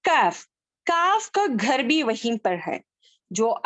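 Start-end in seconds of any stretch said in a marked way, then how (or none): 0.0-0.4 काफ said loud and clear; letter demo. Shown as a demonstration. Teacher introducing the letter काफ. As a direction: call out काफ distinctly.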